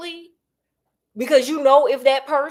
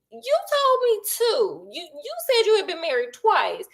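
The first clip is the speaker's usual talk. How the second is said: higher voice, a touch of disbelief; lightly voiced, incredulous girlfriend; stunned girlfriend; 8 semitones higher than usual. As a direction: play the girlfriend who just caught the lie. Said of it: "she's just sitting there like".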